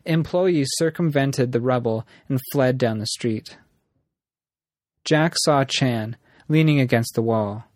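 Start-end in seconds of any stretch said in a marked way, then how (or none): none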